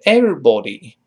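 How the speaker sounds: British "everybody" with rounded o in body; British pronunciation. Shown as a demonstration. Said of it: "英式发音是everybody". (英式发音“everybody”，body发圆唇“哦”)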